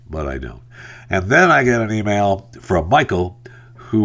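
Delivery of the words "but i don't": much softer, lower "but I don't"; hushed, resigned "but I don't"; quiet resignation. Acted. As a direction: drop to a rueful near-murmur on "but I don't". Resisting urge to reply.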